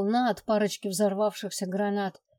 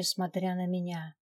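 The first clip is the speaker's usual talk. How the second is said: ordinary delivery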